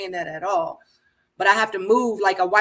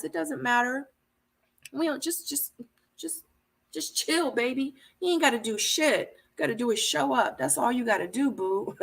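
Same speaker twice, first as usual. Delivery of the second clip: higher, softer coaxing coo; Zadia cooing; 3 semitones higher, 6 dB quieter than usual. Retold or imitated. Zadia telling Cam just show up, soft higher coo. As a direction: soothe him like a lazy lover. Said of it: "She said".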